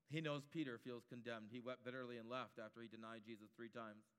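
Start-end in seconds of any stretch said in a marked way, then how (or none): none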